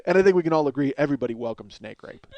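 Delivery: usual speaking voice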